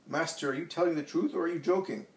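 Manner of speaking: put-on high, jolted question from a shocked old man; old scribe caught off guard, startled; startled disbelief. A narrator's reading. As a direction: blurt it out as if bitten by a snake. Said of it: "The old man jumps as if he had been bitten".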